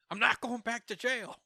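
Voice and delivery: high-pitched, defiant repeat; hammed-up high protest; mock outburst. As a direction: blurt it out high, acting a villain's panicky refusal. Moro's refusal repeated, escalated.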